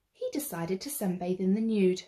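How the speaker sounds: usual speaking voice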